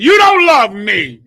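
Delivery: a slow, loud accusing cry; hammed-up wail of self-pity from the drunk; mock drunken outburst. Imitating a miserable drunk's outburst.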